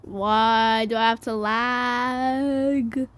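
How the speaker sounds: slow, stretched-out lament; drawn-out complaint. Real, not acted. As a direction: drag every word out in complaint. Whining about lag.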